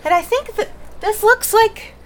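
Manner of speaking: high, loud, breathless rush; winded Radcliffe. Acted. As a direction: blurt it out, out of breath and excited. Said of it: "very windedly".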